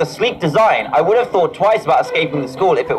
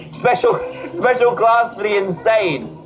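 laughing mockery; 5 semitones higher than usual. Real, not acted. Mocking the special class.

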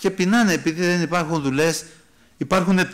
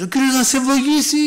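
loud, high-pitched blessing yelled out; imitating a shouted blessing; 10 semitones higher, 5 dB louder than usual. Preacher re-enacting a brother yelling at another driver, shouted blessing. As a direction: bellow the blessing like an angry curse, pitched way up. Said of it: "σηκώνει το χέρι και φωνάζει".

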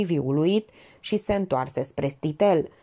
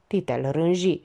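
usual speaking voice

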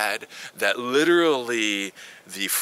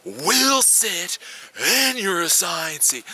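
high, very slow mock order; bossy stewardess; 12 semitones higher, much slower than usual. Stewardesses reseat families on the plane, prim high mock command. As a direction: lay down the law like a rigid stewardess. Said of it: "They're like".

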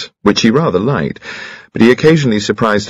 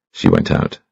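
plain reading voice

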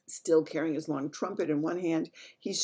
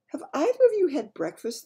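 the Queen; 11 semitones higher, 8 dB louder than usual. Acted. Queen offering breakfast.

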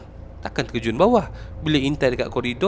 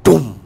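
a sharp loud "dum" for a heavy crash; loud thud imitation; 8 dB louder than usual. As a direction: slam out the crash sound. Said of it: "kedengaran bunyi ... yang kuat".

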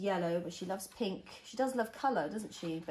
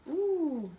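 excited ooh; 6 semitones higher, 4 dB louder than usual. Acted. Excited aside in story.

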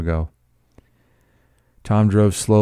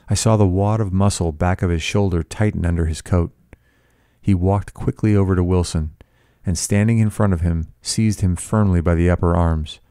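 plain reading voice

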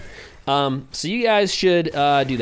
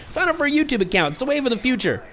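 mock exasperated urging; 9 semitones higher than usual. Badgering viewers without an account.

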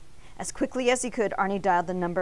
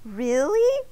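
higher, hopeful question; raised, rising surprise; relieved; 6 semitones higher, 5 dB louder than usual. A narrator's reading. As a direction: ask it in dawning relief. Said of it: "with a huge sigh of relief".

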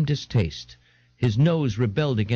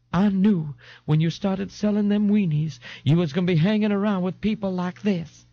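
high, wailing mother voice; plaintive reproach in a pitched-up voice; Missus Riley, upset; 8 semitones higher than usual. Acted. Mother reproaching her son over his company, upset.

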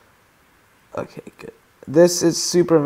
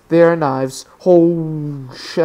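normal talking voice

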